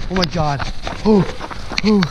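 normal talking voice

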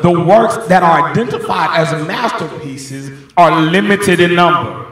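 insistent preacher projecting to the whole sanctuary; preaching at full force. Real, not acted. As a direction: build the point at full pulpit volume.

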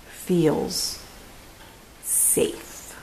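breathy, fading whisper; whispered safe. A narrator's reading. Ending the bedtime story, whispering that bear feels safe. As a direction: tuck the listener in gently.

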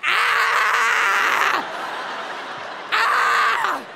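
high loud screams of pain; labor screams. Retold or imitated. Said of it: "She's like".

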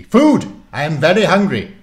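very loud, high cry of hunger; loud demand. Acted. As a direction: bellow for food. Warlock demands food.

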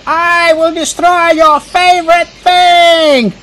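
high, drawn-out threat; theatrical high-pitched outburst of rage; mock scream. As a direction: scream it slowly like a hammed-up little villain. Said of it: "I screamed out".

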